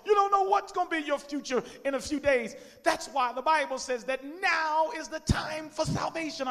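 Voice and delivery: shouted high altar call; preaching. Real, not acted. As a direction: preach it out at full force. Altar call at full force.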